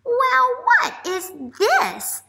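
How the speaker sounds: teacher voicing the little duck; curious little duck. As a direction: play a puzzled little duckling. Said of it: "said the little duck".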